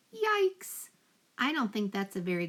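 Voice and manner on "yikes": high, squeaky cry of fright; teacher repeats the yelp for the kids; alarmed yell. Shown as a demonstration. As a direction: shriek it like a scared man. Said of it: "If someone yells".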